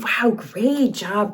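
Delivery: plain reading voice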